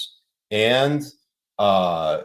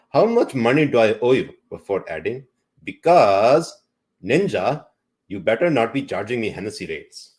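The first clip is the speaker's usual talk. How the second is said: put-on Indian accent; 3 semitones higher, 6 dB louder than usual. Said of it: "read some of the text in your Indian accent".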